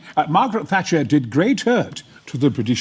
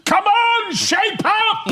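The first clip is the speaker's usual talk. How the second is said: high shouted scolding; mock shout; much slower than usual. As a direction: bark it like a drill sergeant. Mocking a former minister.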